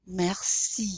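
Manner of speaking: "Merci" as mehr-SEE with a long ee; French "Merci", ending on a clear ee; French I sound. Shown as a demonstration. Teacher gives an example, French "Merci". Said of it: "that's how it's pronounced".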